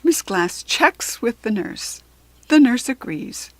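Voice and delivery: ordinary delivery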